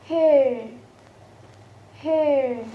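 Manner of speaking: "hair" drawn out with falling pitch; example word demo. Shown as a demonstration. Teacher giving an example word, drawn-out pronunciation demo. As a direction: model "hair", gliding through the vowel. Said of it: "as in the word of".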